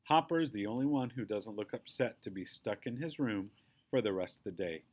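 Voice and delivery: ordinary delivery, nothing marked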